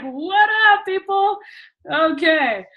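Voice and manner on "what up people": mock greeting. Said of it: "I'm like".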